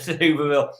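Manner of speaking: usual speaking voice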